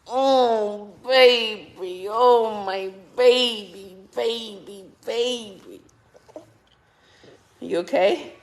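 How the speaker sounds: high, loud loving coo. Real, not acted. Cooing hug to baby.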